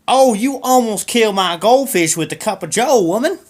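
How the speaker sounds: shouting at wife. Acted. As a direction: bellow at the woman for nearly killing the fish.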